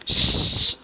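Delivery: श hissed out long like a whistle, no voice; a steady breathy hiss on श, like a whistle; whistling sh. Shown as a demonstration. Teacher to students, demonstrating श as a whistle-like hiss. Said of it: "सीटी जैसे बजते हैं".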